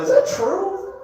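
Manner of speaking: very high, rising question; incredulous question. Real, not acted. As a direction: squeak it out in disbelief. Asking the ladies, incredulous.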